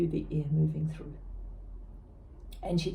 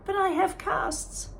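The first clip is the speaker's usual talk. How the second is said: confused protest; 14 semitones higher than usual. Acted.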